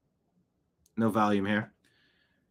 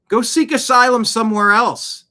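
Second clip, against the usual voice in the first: higher, louder angry jab; raised angry retort; 9 semitones higher, 8 dB louder than usual. Real, not acted. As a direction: fire it back loudly. Angry retort at asylum housing.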